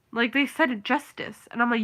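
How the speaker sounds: ordinary talk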